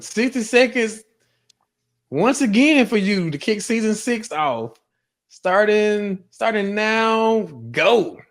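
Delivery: high, drawn-out starting call; announcer's count-in. Real, not acted. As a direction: build suspense toward the starting signal. Host counts in the timed rant.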